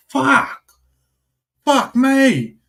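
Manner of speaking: high, loud retold cry of disbelief; drawn-out, high-pitched swearing in astonishment; stunned exclamation. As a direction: blurt it high, re-enacting the shock. Retold shock at deer pulling away.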